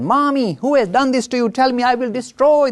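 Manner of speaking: put-on high, loud little boy, ready to fight; a fierce tiny child demanding who hurt his mother. Little Ganesh to his mommy, high and fierce.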